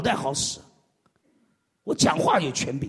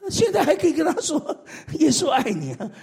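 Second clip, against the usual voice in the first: laughing; 8 semitones higher than usual. Real, not acted. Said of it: "laugh heard".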